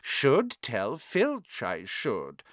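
slow, mock-innocent poltergeist; saintly voice. A narrator's reading. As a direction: sound pious but sly. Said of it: "in a saintly voice".

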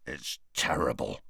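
low, soft growl into a beard. A narrator's reading. Hagrid growls into his beard about the attacks. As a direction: grumble it deep and low. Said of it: "growled Hagrid into his beard".

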